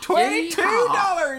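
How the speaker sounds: loud, high cowboy voice with a laugh; a laughing frontier cowboy; old Western accent. Acted. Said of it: "makes you talk like an old Western dude".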